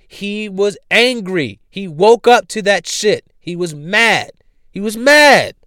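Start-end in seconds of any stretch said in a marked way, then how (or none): 4.7-5.5 higher and louder, stressing mad; louder mock-mad repeat. Shown as a demonstration. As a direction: push it up like anger. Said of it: "came off mad and angry".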